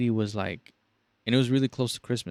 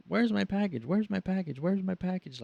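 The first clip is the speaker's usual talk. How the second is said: high, whiny repeated demand; nagging customers; 8 semitones higher, 3 dB quieter than usual. Retold or imitated. Callers asking the agent over and over, high and whiny. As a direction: drone on like an endless caller.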